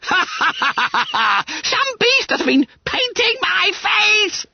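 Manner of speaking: high, drawn-out squealing outrage; yelled. A narrator's reading. Bunter realises someone painted his face, yelling. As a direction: wail it at the top of the voice. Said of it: "yelled Bunter".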